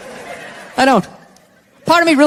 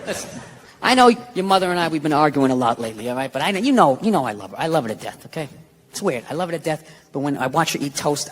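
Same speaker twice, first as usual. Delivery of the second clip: mock sincere; 8 semitones lower, 4 dB quieter than usual. Mock heartfelt dad talk.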